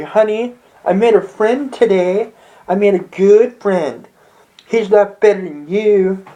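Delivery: lightly voiced lover on the phone; sweet phone voice. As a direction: coo into the phone. Driver talks to boyfriend.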